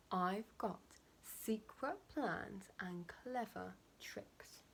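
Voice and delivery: slow, hushed secret; conspiratorial whisper. Acted. Crocodile whispers to the bird, slow and secretive. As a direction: whisper it slowly like a sly plotter.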